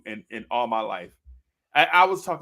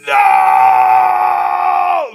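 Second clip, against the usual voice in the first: long, high hammed-up howl; anguished kid; 16 dB louder than usual. Boy humiliated before a girl by father, drawn-out mock scream.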